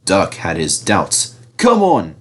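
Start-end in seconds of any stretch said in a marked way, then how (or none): 1.6-2.2 curt. A narrator's reading. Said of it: "he said shortly".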